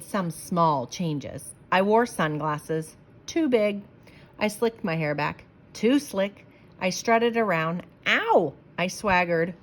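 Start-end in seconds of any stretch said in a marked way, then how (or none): none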